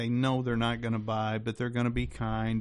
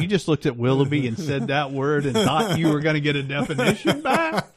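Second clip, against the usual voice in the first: laughing tease; 2 semitones higher, 5 dB louder than usual. Real, not acted. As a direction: tease him through real laughter. Teasing co-host, breaking into laughter.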